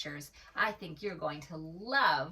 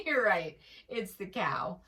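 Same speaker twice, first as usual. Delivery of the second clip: usual speaking voice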